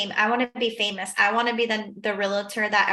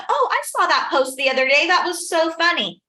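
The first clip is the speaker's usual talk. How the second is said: amused neighbor; 6 semitones higher, 5 dB louder than usual. Retold or imitated. Neighbor voiced at open house.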